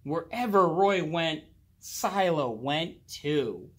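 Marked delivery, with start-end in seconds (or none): none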